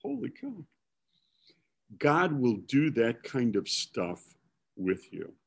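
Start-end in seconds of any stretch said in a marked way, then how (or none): 0.0-0.7 awed whisper. Retold or imitated. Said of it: "And I'm going".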